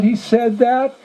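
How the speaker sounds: high, rising disbelief; astonished question. Retold or imitated. Visitor hearing the priest praised him, astonished. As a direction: ask it amazed, pitched right up.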